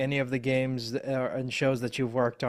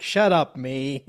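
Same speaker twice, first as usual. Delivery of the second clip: mock self-scolding; 8 dB louder, slower than usual. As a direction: heckle your own character on screen. Host acts viewer scolding own character.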